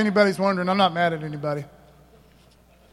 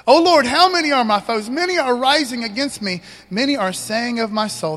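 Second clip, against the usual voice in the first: loud, higher cry to the Lord; loud, higher psalm cry; loud psalm reading; 4 semitones higher, 6 dB louder than usual. Acted. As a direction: cry the verse out.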